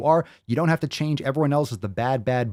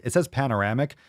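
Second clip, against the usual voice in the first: ordinary talk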